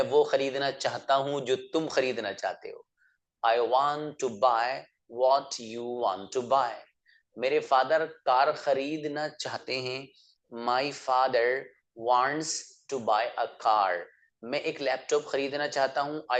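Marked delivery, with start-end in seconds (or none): 3.5-6.7 very slow, every word spelled out for learners; slow model sentence. Shown as a demonstration. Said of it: "teacher models English sentence". 10.5-14.0 very slow, every word spelled out for learners; slow model sentence. Shown as a demonstration. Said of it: "teacher models English sentence".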